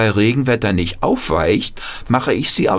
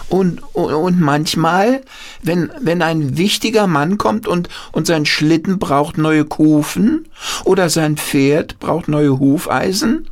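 put-on higher, childlike and breathless with stumbling starts; eager grandchild voice; 10 semitones higher than usual. Grandchild pleading with Opa Paul, eager childlike voice. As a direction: play an excited little grandchild asking.

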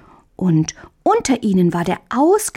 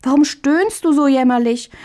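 higher, worried questioning; alarmed concern; 7 semitones higher than usual. A narrator's reading. Brother bending over the groaning sick one, alarmed concern. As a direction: anxiously press for what is wrong. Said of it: "sprang erschrocken aus dem Bett".

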